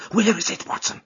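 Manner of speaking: high, urgent, hushed question; thrilled, shaken voice. A narrator's reading. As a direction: ask anxiously, dropping to a whisper on the name. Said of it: "from the thrill of his voice".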